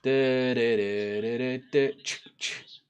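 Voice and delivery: toneless "du du" filler with soft "ch" clicks; idle vocal noises. Real, not acted. Streamer checking the calendar, idle du-du noises. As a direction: doodle with the voice while waiting.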